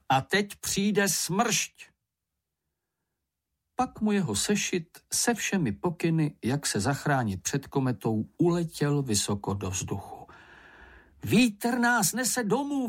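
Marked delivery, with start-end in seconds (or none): 0.1-1.8 high, slow alarmed announcement; calling out. A narrator's reading. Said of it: "zvolal Ferko". 11.2-12.9 high, drawn-out cry; excited cry. A narrator's reading. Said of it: "vykřikl Mumínek".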